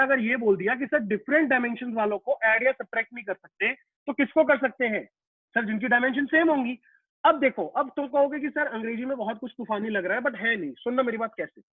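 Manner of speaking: ordinary delivery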